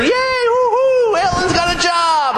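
very high, slow, whooping celebration; mock cheering. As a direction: cheer for her like a crowd. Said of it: "we can all jump in together".

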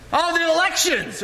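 high-pitched exasperated groaning words; mock exasperation. Complaining about the elections, mock exasperation. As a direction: moan it high, hammed-up.

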